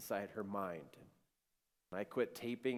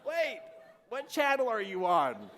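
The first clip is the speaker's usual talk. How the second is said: high, loud comic incredulity; baffled disbelief; 9 semitones higher, 6 dB louder than usual. Retold or imitated. Husband questions wife's strange claim, baffled disbelief. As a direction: blurt it out, pitched way up.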